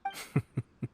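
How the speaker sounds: short little chuckle; teasing chuckle. Acted. Boss teasing the listener about a job, light chuckle. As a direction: chuckle lightly at your own joke.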